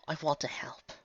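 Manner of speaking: a soft whisper of hope; whispered plea. Acted. Octavia pleads quietly, in a whisper. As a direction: breathe it out, barely voiced.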